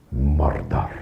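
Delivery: a hammed-up Scottish TV detective accent, low and deliberate; Taggart accent. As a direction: lean into the mic and say it like Glasgow detective Taggart. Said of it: "I'd put on my best Taggart accent".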